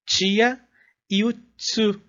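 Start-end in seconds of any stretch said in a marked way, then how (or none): 0.1-0.6 "tia" with a Brazilian chi sound; Portuguese tia. Shown as a demonstration. Teacher giving a Portuguese example of the ti sound. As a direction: say "tia" as a Brazilian does, chee-ah. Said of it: "como a gente fala em".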